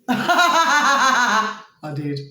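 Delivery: laughing admission to a friend. Real, not acted. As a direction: laugh then I did. Laughs recalling last year.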